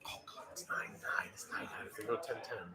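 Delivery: very quiet, anxious muttering; tense near-whisper. Real, not acted. Bettor watching a tied score, tense near-whisper. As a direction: barely voice it, under the breath.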